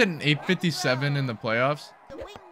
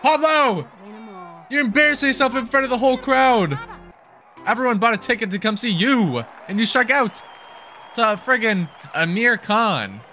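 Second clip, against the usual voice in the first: mock scolding; 7 semitones higher than usual. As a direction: yell at the player like an angry coach. Scolding player who struck out.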